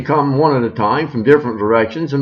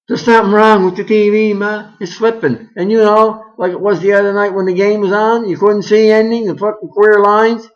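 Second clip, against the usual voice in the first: put-on complaining kid; 9 semitones higher, 5 dB louder than usual.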